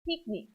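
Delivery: Picnic said as "peek-neek"; Hebrew pronunciation. Shown as a demonstration. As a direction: say Picnic the Israeli way, with two ee vowels. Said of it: "How do you say picnic in Hebrew?".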